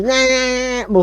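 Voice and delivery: high mocking nah nah nah; mock gibberish. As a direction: imitate foreign babble dismissively. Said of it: "started speaking Undercommon at me".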